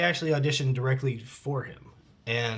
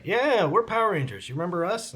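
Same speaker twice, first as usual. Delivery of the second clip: higher-pitched, eager mock caller; upbeat callback; 5 semitones higher than usual. Producers calling an actor back months later, upbeat imitation.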